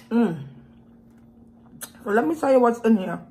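chewing while talking; mouth full. Real, not acted. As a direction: lips pursed around food. Seen 3: puts meat in mouth at 3.6, chews while speaking after.

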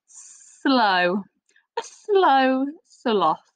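"A slow sloth" said very slowly; slow stretched phrase. Shown as a demonstration. Teacher showing the s sound, slow and drawn out. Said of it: "has our sound today".

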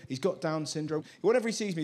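ordinary talk

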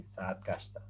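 Saat Kashta with a long Saat and a hard t in Kashta; Turkish pronunciation. Shown as a demonstration. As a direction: model Saat Kashta for the class. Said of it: "Por eso se dice así".

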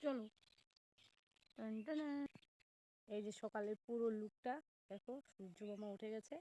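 talking with a toothbrush in mouth; while brushing teeth. Real, not acted. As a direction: speak around the toothbrush. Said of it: "brush করতে করতে".

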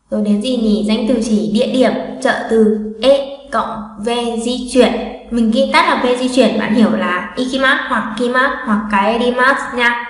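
ordinary talk

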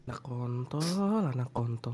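very soft, low swearing; low, barely audible grumble; muttered curse. Real, not acted. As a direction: curse under your breath at a friend. Swears under breath at a confession.

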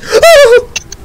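very high, loud squeal; startled squeal. Real, not acted. Gamer startled by the game, real high squeal. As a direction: squeal out in sudden fright. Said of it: "You made me squeal".

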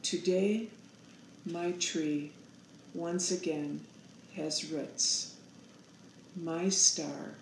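plain reading voice

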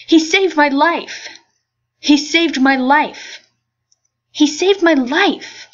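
high, loud, slow gushing; excited. Acted. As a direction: gush with delight. Character gushing over her hero.

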